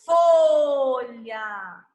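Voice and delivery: "Folha" drawn out long, loud start sliding down; slow, elongated "Folha", fading off; stretched sound. Shown as a demonstration. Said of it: "Eu estico o som".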